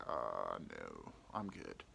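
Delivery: deadpan refusal. Retold or imitated. Turning down the gonorrhea woman, low slow deadpan. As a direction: drop low and flat-out decline. Flat refusal of her offer.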